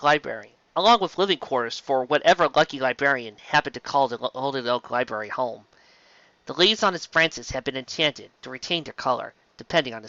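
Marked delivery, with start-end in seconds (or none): none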